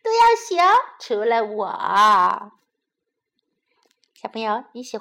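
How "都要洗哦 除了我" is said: tease, drawing out the last words; gleeful small bunny; giggly little bunny. A narrator's reading. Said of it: "兔宝宝咯咯地笑着说".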